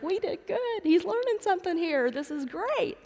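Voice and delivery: high, pleased, self-congratulating voice; a hammed-up proud parent's glee; proud parents. Parents silently congratulating each other in church, high and pleased. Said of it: "looking at each other like".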